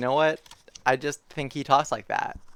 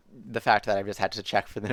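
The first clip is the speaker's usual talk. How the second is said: ordinary delivery, nothing marked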